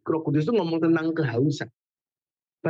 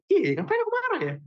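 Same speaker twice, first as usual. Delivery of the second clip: much higher, puzzled self-question; high, bewildered musing to yourself; dazed realization; 14 semitones higher than usual. Retold or imitated. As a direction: suddenly wonder why you were angry. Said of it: "jadi kayak mikir kayak".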